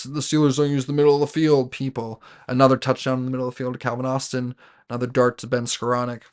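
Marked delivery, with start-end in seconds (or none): 0.0-1.7 a lightly voiced mock of the critics, louder; mocking the doubters. Fan mocks the critics who say the Steelers avoid the middle, lightly voiced mock tone. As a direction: parrot the naysayers with a sneer. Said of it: "for these people".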